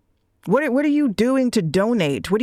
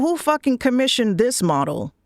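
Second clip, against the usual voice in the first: normal talking voice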